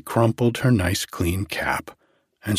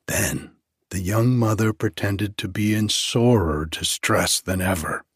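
plain reading voice

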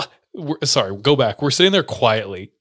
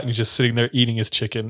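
ordinary talk, nothing marked

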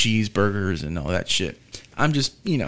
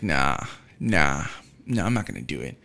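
lower, softer self-talk; low muttered refusal; 10 semitones lower, 5 dB quieter than usual. Retold or imitated.

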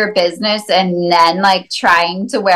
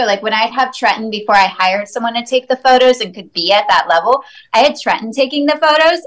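ordinary talk, nothing marked

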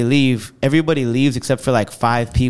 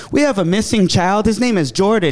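higher-pitched mock PA announcer; loudspeaker announcement; 7 semitones higher than usual. Bookstore staff announce a missing child over speakers, mock announcement. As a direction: call it out like a store announcement. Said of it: "they get on the speaker and they go".

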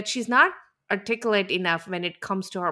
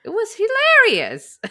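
high, loud, drawn-out laughing delivery; amused exclamation; 7 dB louder than usual. Real, not acted. Co-host agrees the dinner moment was funny, laughing.

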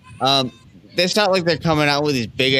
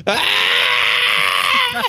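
a short mock goat scream; a quick imitated animal cry; goat scream imitation; 5 semitones lower than usual. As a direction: yelp like a goat in the woods. Imagined goat screaming in woods.

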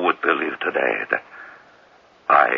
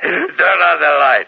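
slow, loud, raised command; loud order; 12 semitones higher, 5 dB louder than usual. Acted. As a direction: call out the order slowly. Dictator orders light after prank.

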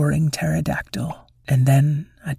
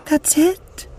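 high disbelieving cry; indignant cry; 13 semitones higher than usual. Acted. Wyla protests the sad ending, high and disbelieving. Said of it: "cried Wyla".

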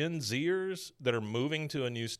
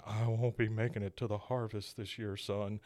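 put-on low, soft old-man voice; lowered, fading film-character line; dying movie father; 3 semitones lower, 6 dB quieter than usual. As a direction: lightly act last words to a son. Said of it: "you hear on the screen".

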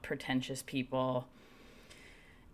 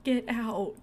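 shouting; 8 semitones higher, 4 dB louder than usual. Retold or imitated. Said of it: "everyone's like".

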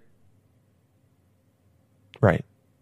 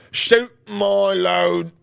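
drawn-out mock line; 7 semitones higher, much slower than usual.

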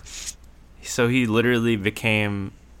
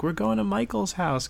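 parent voice; 13 semitones higher than usual. Retold or imitated. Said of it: "my parents were like".

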